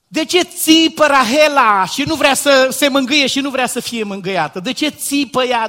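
high, loud, rising indignation; impassioned preaching. Acted. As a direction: hammer each question at the hall. Preaching at full force.

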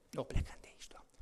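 hushed, fast whispered prayer; whispered plea. Acted. Moses begs God not to leave, in a whisper. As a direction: breathe it as a timid plea.

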